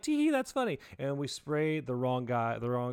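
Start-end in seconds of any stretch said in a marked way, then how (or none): 0.0-0.8 put-on high, silly giggly girlfriend voice; mocking girlfriend. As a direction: mimic her giddy tee-hee. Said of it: "And she's like".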